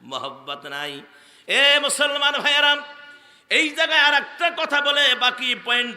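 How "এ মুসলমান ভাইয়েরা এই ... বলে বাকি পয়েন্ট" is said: high, loud preacher's call; preaching at full force. Real, not acted. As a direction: ring it out to the whole congregation. Preacher calls out to congregation.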